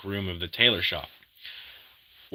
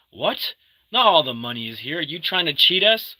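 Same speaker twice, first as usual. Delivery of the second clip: mock angry protest, pitched higher; pretend argument; 6 semitones higher than usual. Englishman faking a quarrel with the French gang, mock angry. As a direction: fake outrage over the money, voiced up high. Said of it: "pretending to argue with them".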